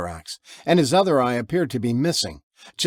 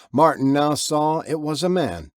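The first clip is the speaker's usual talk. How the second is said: usual speaking voice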